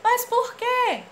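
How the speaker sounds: slow, high, loud mas por quê; insistent high question. Acted. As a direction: press the question, drawn out. Voiced character insisting. (pergunta insistente, aguda e arrastada)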